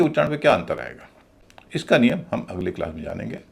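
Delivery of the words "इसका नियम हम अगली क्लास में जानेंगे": ordinary delivery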